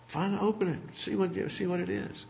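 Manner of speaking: playful egging on, barely acted; a hint of an eager friend.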